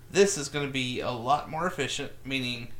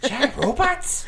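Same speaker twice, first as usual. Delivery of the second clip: higher, slow baffled tough guy; 11 semitones higher, 5 dB louder, slower than usual. Acted.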